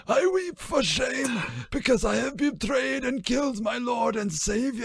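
high, ragged wailing voice; ragged Judas. A narrator's reading. Judas confessing his shame to the voice, high and ragged. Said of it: "replied in a ragged voice".

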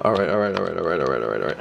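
low repeated muttering, sinking away; nervous muttering. Real, not acted. Freaking out during online checkout, nervous muttering to self.

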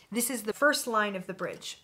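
usual speaking voice

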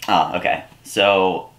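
ordinary talk

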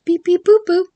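high, loud robot beeping; playful droid beeps, high and loud. Retold or imitated. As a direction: chirp it like a little droid. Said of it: "I just love it when she goes".

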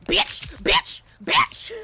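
loud furious yell; cursing at the escaping survivor; angry shout. Real, not acted. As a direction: spit the curse out loud.

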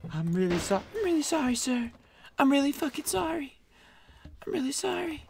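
high, soft apologetic voice; timid assistant. Acted. Assistant apologises to the doctor, high and soft. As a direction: plead sorry meekly.

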